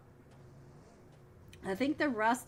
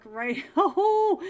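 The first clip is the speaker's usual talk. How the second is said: laughing; 7 semitones higher, 10 dB louder than usual. Real, not acted. Caster reacts to a play.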